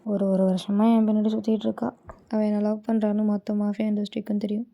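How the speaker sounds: plain reading voice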